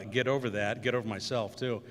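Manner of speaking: normal talking voice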